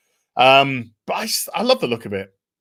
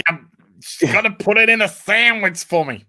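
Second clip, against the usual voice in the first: higher-pitched, hammed-up American accent; American accent attempt; 5 semitones higher than usual. Trying an American accent for the co-host, hammed-up. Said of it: "I can't do American accents".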